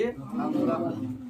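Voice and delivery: अंग drawn out very slowly and breathed; slow nasal अंग demo; nasal letter demo. Shown as a demonstration. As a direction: hold अंग, letting it ring through the nose. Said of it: "नाक का भी जो है इसमें उच्चारण".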